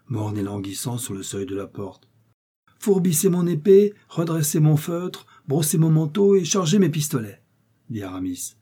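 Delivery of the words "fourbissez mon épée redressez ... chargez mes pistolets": high, ringing string of commands; excited master commanding his valet; eager orders. A narrator's reading. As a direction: rattle off orders to a servant, pitched up. Aramis revived, ordering his servant about.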